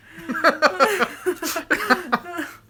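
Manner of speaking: high-pitched fit of giggles; real laughter. Real, not acted. Laughing at own accent attempts, high giggling. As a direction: burst out in a long, high laugh.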